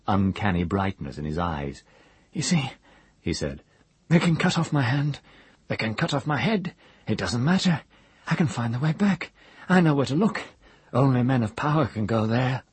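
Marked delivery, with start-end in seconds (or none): 2.3-2.7 high, eerie half-mad voice; a broken man stating a dark truth; crazed old wizard. A narrator's reading. Hare's voice. 4.1-12.6 high, eerie half-mad voice; a broken man stating a dark truth; crazed old wizard. A narrator's reading. Hare's voice.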